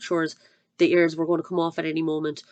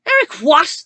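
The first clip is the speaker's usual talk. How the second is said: high, louder demanding shout; 9 semitones higher, 10 dB louder than usual. Acted. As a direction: bark the question.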